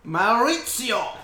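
slow, hammed-up Italian accent; drawn-out fake Italian accent; mock Italian accent.

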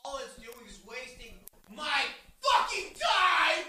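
blows up at the screen, dragging out the last words; furious shout. Real, not acted. Fed-up wrestling fan yelling at the show, furious shout.

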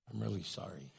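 low, very soft contrition; meek apology. Acted. Wife apologizing back to her husband, low meek voice. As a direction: say it low and small.

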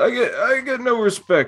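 a hint of a whiny comic; Rodney Dangerfield impression. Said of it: "he's like".

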